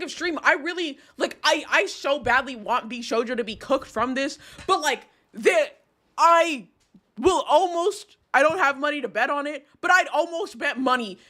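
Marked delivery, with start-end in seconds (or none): none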